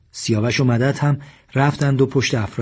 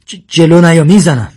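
high, loud stuttered warning; stammering; 6 semitones higher, 12 dB louder than usual. A narrator's reading. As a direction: stutter the first sound, then shout in panic. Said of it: "و با تته پته گفت". (هشدار بلند با تته پته)

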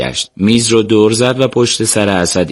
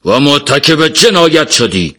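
slow, raised shout of accusation; narrated shout; 5 semitones higher, slower than usual. A narrator's reading. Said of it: "بازجو فریاد زد".

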